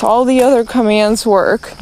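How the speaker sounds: usual speaking voice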